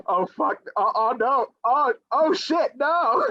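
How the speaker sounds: rising, drawn-out groans of dismay, slow; mock dismayed viewer. Midnight crowd reacting to bad movie, mock dismay. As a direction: imitate an audience wincing at the screen. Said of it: "people just going".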